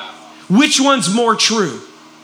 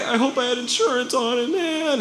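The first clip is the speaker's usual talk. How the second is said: mock defeated whine; 3 semitones higher, 6 dB quieter than usual. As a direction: imitate a victim giving up, softly. Imitates self-pitying victims.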